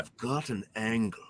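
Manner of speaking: jaw stuck out, low and soft; underbite. Shown as a demonstration. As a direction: push the jaw forward and say it. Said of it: "You gotta stick your jaw out".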